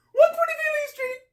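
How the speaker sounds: "One twenty-fifth Street" squeaked very high; frantic high-pitched address; panicked squeal. Retold or imitated. Panicked caller gives address.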